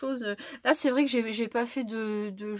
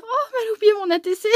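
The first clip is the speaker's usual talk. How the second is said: much higher, fast mock-dismayed friend; imitating Tinou; 10 semitones higher, 9 dB louder, faster than usual. Imagining Tinou's reaction to the forgotten card, high mock voice. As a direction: squeak out her imagined complaint, quickly. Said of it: "tu parles pas comme ça".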